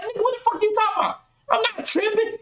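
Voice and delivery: high-pitched, indignant comeback; worked-up retort. Acted. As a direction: fire back a heated denial, pitched well up. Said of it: "I see the veins coming through your neck".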